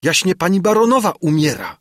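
urgent dismay; distressed announcement. A narrator's reading. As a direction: blurt out the terrible news. Servant announces lady is dying.

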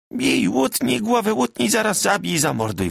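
high, pushy taunting wail; insistent shrill cry. A narrator's reading. Magda provoking Bartek in the tavern, insistent cry. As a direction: dare him to hit you. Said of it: "wołała natarczywie".

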